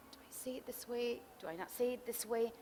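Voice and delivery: near-whisper, unsure, trying out the words; hushed inner rehearsal. Retold or imitated. Spouse rehearsing a hard confession to partner, hushed. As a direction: murmur it as if practising privately in your head.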